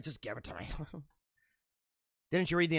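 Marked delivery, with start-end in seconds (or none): 0.0-0.7 low, soft, fed-up wife voice; wife taking over. Retold or imitated. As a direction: hand it over, done with him, quietly. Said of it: "And she's like".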